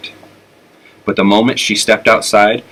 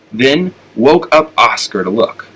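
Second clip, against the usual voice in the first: ordinary delivery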